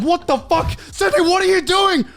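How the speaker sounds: high, loud alarmed yell; shouting in disbelief at a friend; retold alarmed shout. Sees Sydney smashing Tim Tams, high alarmed shout. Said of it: "and I'm just like".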